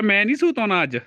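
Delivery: put-on feed the child a playful refusal; speaking for toddler. Models child's refusal to behave. (ਬੱਚੇ ਵੱਲੋਂ ਸ਼ਰਾਰਤੀ ਲਹਿਜ਼ੇ ਵਿੱਚ)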